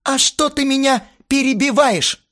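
very high, loud indignant protest; flaring up. A narrator's reading. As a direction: flare up at being cut off. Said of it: "взвился Астарот". (взвившись, высоко и громко)

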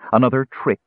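ordinary delivery, nothing marked

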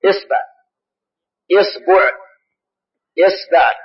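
إصبع said "isbi', isba', isbu'", i on hamza each time; إصبع three ways, always starting with i; teacher listing the kasra forms of إصبع; kasra on hamza, vowel of ba varied. Shown as a demonstration. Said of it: "نأخذ كسر الهمزة".

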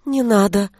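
ordinary delivery, nothing marked

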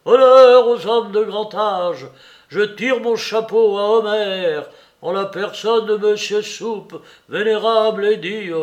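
loud, high ceremonial proclamation; pompous shouted homage; solemn shouting. A narrator's reading. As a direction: declaim grandly through a wall. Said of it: "solennel, criait à travers la muraille".

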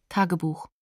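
normal talking voice

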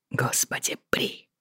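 muffled whisper into her hair; face in someone's hair. A narrator's reading. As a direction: breathe it softly with your face buried. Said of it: "пробормотал он, уткнувшись лицом в её волосы".

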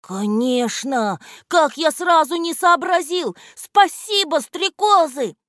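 high, loud, delighted exclamation; радостный возглас. A narrator's reading. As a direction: burst out with sudden realisation. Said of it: "воскликнул Антон". (радостно, громко воскликнуть)

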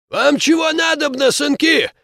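put-on high, loud toothless old man; mumbling old man. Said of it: "прошамкал старик".

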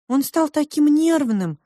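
high, slow chorus of twins; high-pitched twin characters; twins in unison. A narrator's reading. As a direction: stretch it out high, as two twins chiming together. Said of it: "сказали они в унисон".

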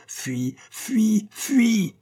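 very high, tragic cries. A narrator's reading. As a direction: banish the monster like a tragedian. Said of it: "prononcé d'une façon tragique".

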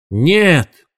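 high drawn-out cry of protest; alarmed cry. A narrator's reading. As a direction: call out to stop an attacker. Douglas bursts in to stop him.

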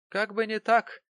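high, loud dismissal; scoffing retort. A narrator's reading. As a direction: scoff at the very idea. Landlady scoffs at the remark.

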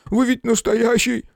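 high, near-shouting panic; almost yell it in panic. A narrator's reading. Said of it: "едва не заорал Федышин".

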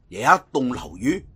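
usual speaking voice

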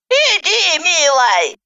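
put-on high, coaxing old woman's voice; doting granny. A narrator's reading. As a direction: coax a small child. Granny to child. (ласковая бабушка)

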